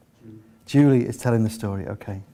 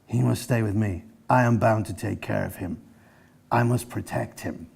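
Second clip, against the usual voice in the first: solemn protective brother; 3 semitones lower, 3 dB quieter than usual. A narrator's reading. Chinggis refuses to part.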